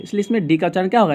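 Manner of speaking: normal talking voice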